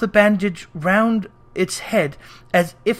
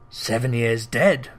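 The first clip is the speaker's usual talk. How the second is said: musing; 7 semitones lower, slower than usual. Acted. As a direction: think it over aloud. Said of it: "mused Scrooge".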